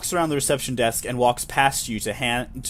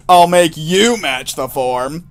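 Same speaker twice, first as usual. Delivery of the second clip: mock threat; 4 semitones higher, 10 dB louder, much slower than usual. Player threatens the administration.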